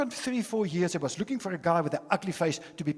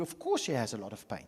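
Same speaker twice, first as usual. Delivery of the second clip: ordinary talk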